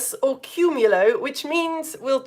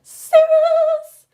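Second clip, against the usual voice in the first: as high-pitched as you can; loud, very high, held cirrus; pop star squeal; 10 dB louder than usual. Shown as a demonstration. Teacher tossing hair like a pop star, loud high-pitched squeal. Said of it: "very as high pitched as you can".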